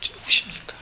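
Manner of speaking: whispered, meek question; soft whisper of surrender; humble whisper. Retold or imitated. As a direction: breathe it out humbly. Melted heart, humble question.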